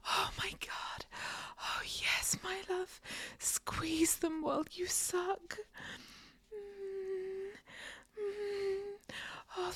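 ordinary talk, nothing marked

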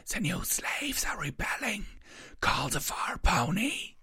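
high, whispery wraith voice; wailing shadow. A narrator's reading. Shadow warns that the slaves rebel, wailing. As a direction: wail eerily in a high whisper. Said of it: "one of the shadows wailed".

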